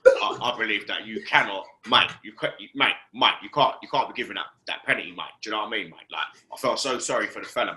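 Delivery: a touch of a former centre back giving his verdict; Rio Ferdinand impression. Fan lightly mimicking Rio Ferdinand's pundit talk. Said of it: "Rio, Rio".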